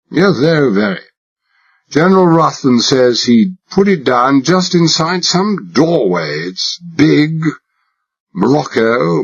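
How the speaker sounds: hammed-up upper-class English drawl; exaggerated British accent. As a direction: play the posh Englishman to the hilt. Narrator voices himself as posh Englishman.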